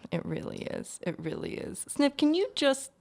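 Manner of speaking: menacing whisper. Acted.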